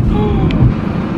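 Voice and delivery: high startled gasp; high gasp at the mess on the wheel; sharp gasp. Real, not acted. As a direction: gasp in shock. Sees something in car.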